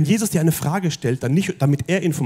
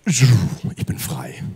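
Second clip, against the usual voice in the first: slow awed whisper; 3 semitones lower, much slower than usual. Acted. The healed woman's quiet realization.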